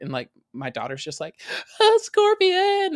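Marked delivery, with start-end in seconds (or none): 1.8-3.0 very high, loud, drawn-out squeal; imitated freak-out. Dad mimicking his daughter at the pool, freaking out. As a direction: mimic a daughter shrieking in alarm. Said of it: "she's freaking out".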